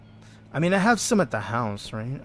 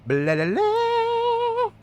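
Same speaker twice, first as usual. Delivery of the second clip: very high, slow, squeaky babble; high, drawn-out creature gibberish; pineapple people voice; much slower than usual. Pretend. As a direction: stretch the syllables way up high like a tiny creature. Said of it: "the language of the pineapple people".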